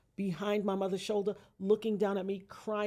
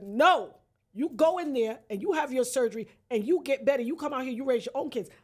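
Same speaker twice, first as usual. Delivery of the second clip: stern mother; 5 semitones higher, 6 dB louder than usual. Retold or imitated.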